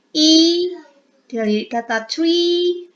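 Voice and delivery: the vowel "E" in "tree" held long and stressed; sustained long "E" as in "tree"; long vowel demo. Shown as a demonstration. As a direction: stretch the "E" sound out clearly. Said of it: "panjang ya".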